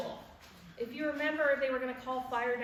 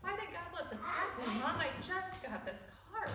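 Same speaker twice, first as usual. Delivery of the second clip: higher-pitched retold plea; upset questioning; 6 semitones higher, 3 dB quieter than usual. Daughter questions mom about the wreck, retold upset pleading. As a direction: imitate a distressed daughter pleading, voice raised in pitch.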